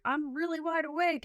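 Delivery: high, chipper boast; overconfident claim. Retold or imitated. Traveller bragging she won't sleep on the plane, chipper and high. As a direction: pitch it up like you're sure. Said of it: "I'm like".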